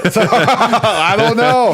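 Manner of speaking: high, loud and slow through laughter; laughing. Real, not acted. Guest gives up the joke, laughing.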